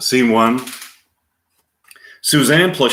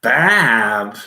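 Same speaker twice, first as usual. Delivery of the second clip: whiny; 4 dB louder than usual. Retold or imitated. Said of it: "in a whiny voice".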